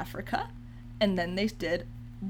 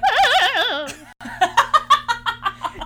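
dying Boo wail; 14 semitones higher, 7 dB louder than usual. Real, not acted. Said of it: "You sounded like a dying Boo in Mario".

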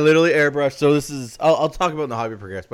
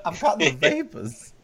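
swooning belle; 4 semitones higher, slower than usual. Pretend. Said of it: "talking about having the vapors".

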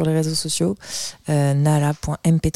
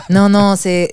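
laughing; 4 dB louder, slower than usual. Real, not acted. Laughs at own jazz joke.

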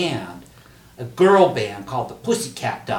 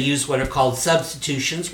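ordinary talk

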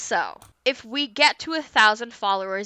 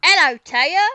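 hammed-up British accent, loud; bad British accent; 3 semitones higher, 7 dB louder than usual. Podcaster paying off a promise to cohost, bad British accent. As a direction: greet in a badly faked British accent. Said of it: "This is a really bad accent".

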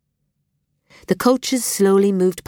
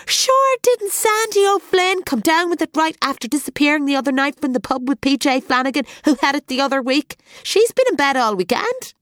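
gossiping Joe; 8 semitones higher than usual. A narrator's reading. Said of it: "He tried to lower his voice".

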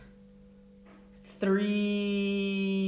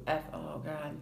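ordinary delivery

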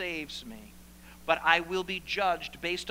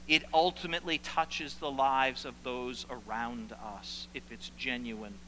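usual speaking voice

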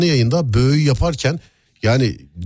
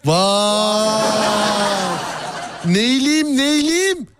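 high mock sing-song; 8 semitones higher, much slower than usual. Mocking sing-song banter.

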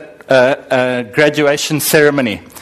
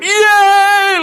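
much higher, exuberant cheer; a proud father cheering his son aloud; joyful yell. Retold or imitated. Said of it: "like this in front of".

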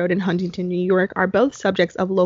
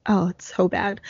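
usual speaking voice

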